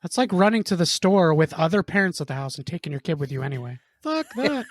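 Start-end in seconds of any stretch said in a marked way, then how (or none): 4.0-4.6 exasperated. Real, not acted. Exasperated outburst.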